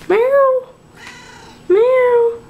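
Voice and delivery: high, loud cat meowing. Retold or imitated. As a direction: meow like a hungry cat. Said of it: "This is what he does".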